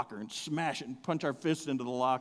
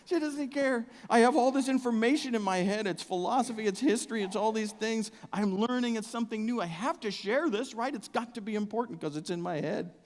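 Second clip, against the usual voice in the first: higher, insistent pleading; eager exasperation; 7 semitones higher than usual. Acted. Husband desperate to share information with uninterested wife, high eager exasperation. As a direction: pour it out, bursting to share.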